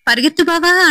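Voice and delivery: high panicked plea to run; high scared cry to flee; frightened cry. Acted. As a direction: yelp it out in terror. Fleeing the ghost in terror.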